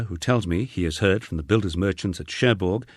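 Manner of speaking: ordinary delivery, nothing marked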